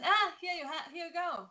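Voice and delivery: a lightly voiced, high, offhand Mother Nature; mock nature voice. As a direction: toss it off like nature giving a gift. Nature casually handing out creatures.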